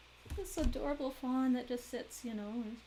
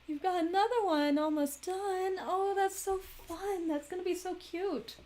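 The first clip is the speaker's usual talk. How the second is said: delighted; 9 semitones higher, 4 dB louder than usual. Real, not acted. Sees nearly finished project.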